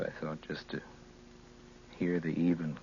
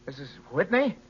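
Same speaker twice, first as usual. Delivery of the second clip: higher and louder, frantic questions; rising panic; 11 semitones higher, 8 dB louder than usual. Acted. Frightened son calling home for his mother, rising panic. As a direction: demand answers as fear takes over.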